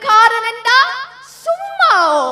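drawn-out, high, loud brag; loud boast. Acted. Character boasting about being a London man, loud and high. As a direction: crow it slowly, voice rising high. Boastful catchphrase in cast introduction.

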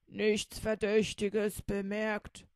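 a hint of a dutiful robber. Will reporting to the captain, lightly voiced dutiful report. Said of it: "sagte Will".

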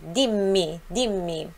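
"Dimmi" with a long, held M, "dim-mi"; strong double M. Shown as a demonstration. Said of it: "som forte".

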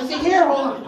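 ordinary talk, nothing marked

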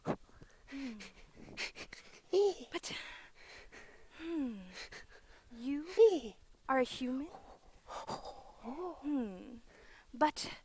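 ordinary delivery

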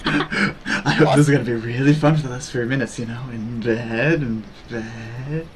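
low, soft seductive murmur; sultry mistress. Pretend. Streamer playing requested role to chat, sultry. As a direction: linger suggestively, with low little laughs. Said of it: "now you're sultry misters too".

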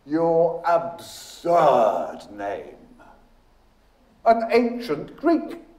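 drawn-out playful teasing, rising high. A narrator's reading. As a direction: stretch the jibe, then lift it lightly. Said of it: "he said gaily".

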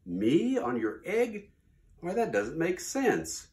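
can't believe the silly idea; amused disbelief. Acted. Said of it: "The elephant laughed".

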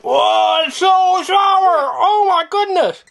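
high squealing cry at the sour taste; sour shock. Real, not acted. Mom to child, reacting to sour candy, high squeal. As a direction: yelp as the sour candy hits.

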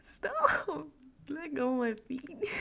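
high squealing laughing plea; laughing protest. Acted. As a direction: squeal it through giggles. Partner pulls her feet.

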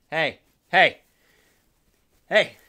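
loud call across the room. Real, not acted. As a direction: call out to get attention. Calling out for someone.

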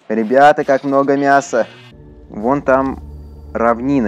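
normal talking voice

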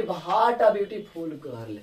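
drawn-out, wondering exclamation; slow exclamation. Shown as a demonstration. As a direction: stretch it out in amazement. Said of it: "देखिए पहला example है कि".

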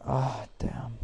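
breathy whispered letdown; whispered curse. Real, not acted. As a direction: whisper it in defeat. After losing the fight.

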